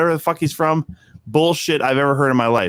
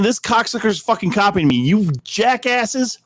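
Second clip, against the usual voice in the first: mock indignant; 7 semitones higher than usual. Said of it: "sent the original one saying".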